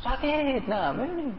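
high, drawn-out mock whine of pain; put-on high-pitched whimper; acted pain complaint. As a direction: imitate someone moaning that it hurts. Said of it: "kan begitu ya".

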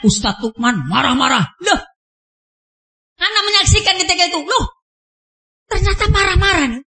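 ordinary delivery

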